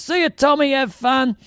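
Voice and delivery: high-pitched singled-out goodbye; acted parting call. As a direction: call back a goodbye while leaving. Voices bloke calling goodbye downstairs.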